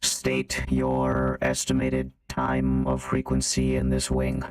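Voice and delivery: low, slow filtered machine voice; robot voice. Acted. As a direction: announce like a security robot.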